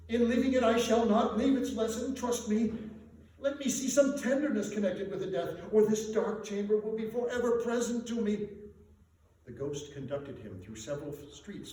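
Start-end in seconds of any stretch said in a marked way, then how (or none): 0.1-8.5 higher, louder desperate plea; pleading Scrooge. Acted. Scrooge begging the Spirit, higher louder plea. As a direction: beg the spirit earnestly.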